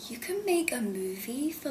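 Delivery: hushed whisper of amazement; whispered disbelief. Retold or imitated. Actor recalling shock at small film budget, hushed whisper. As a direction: whisper it like a secret you can't believe. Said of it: "I, I was like".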